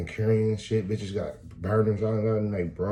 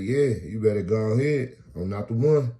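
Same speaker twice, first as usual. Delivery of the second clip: threatening; 4 semitones higher, 6 dB louder than usual. Retold or imitated. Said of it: "He said".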